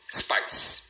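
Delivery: "spice" as one syllable; "spice" in one beat with a hissing final S; all at once. Shown as a demonstration. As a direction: say "spice" in a single go, no vowel before the S. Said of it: "fala de uma vez".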